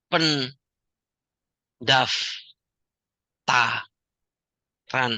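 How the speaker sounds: pendaftaran stretched very long and slow; dramatically drawn-out pendaftaran. Acted. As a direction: hold pendaftaran for suspense like an announcer. Founder announces re-registration dramatically.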